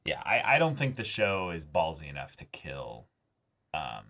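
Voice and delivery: ordinary delivery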